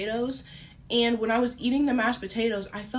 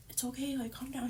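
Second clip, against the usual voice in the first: much softer, almost under the breath, calming him; hushed calming; 2 semitones higher, 10 dB quieter than usual. Retold or imitated. Hushing her boyfriend at the restaurant table, softly. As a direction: quietly settle him down so nobody hears.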